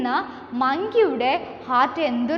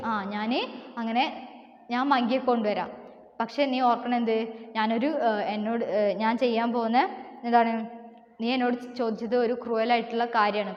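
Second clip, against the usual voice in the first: ordinary talk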